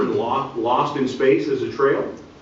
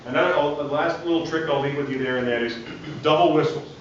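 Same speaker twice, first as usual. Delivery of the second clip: usual speaking voice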